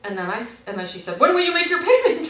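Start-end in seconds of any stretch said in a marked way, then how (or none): 1.2-2.2 loud, high mock demand; demanding imitation. Rep repeating payment question to caller, demanding. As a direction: mimic the rep asking relentlessly.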